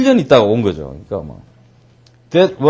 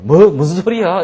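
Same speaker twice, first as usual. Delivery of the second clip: hard of hearing; 6 semitones higher, 5 dB louder than usual. Acted. Deafened listener asking what was said.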